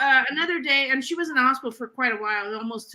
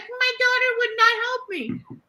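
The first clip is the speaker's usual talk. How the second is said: mock complaining mother; 9 semitones higher than usual.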